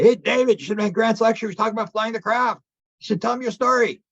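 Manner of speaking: high, eager call across the restaurant table; calling out excitedly. Retold or imitated. Diners calling David over to tell his story, high and excited.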